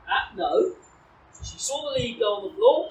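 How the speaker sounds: ordinary talk, nothing marked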